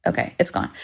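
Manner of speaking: near-whispered aside, plane gone; hushed relief. Real, not acted. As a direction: breathe it out under your breath. Plane noise finally passes.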